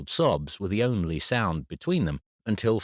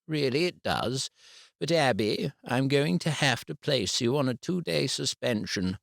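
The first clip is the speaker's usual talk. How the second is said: a hint of a higher, measured teacher voice; mildly raised formal teacher voice; lightly voiced head teacher; 6 semitones higher than usual. A narrator's reading. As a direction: deliver the ruling like a regretful head teacher.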